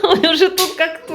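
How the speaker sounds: ordinary talk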